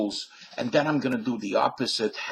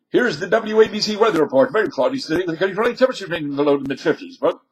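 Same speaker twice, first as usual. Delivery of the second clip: New York accent, rapid and loud, higher; New York AM radio weather report, fast and punchy; 6 semitones higher, 8 dB louder, much faster than usual. Shown as a demonstration. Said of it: "the New York accent and tempo".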